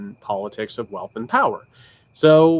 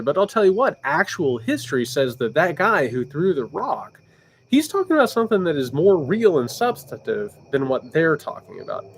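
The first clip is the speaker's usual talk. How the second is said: ordinary delivery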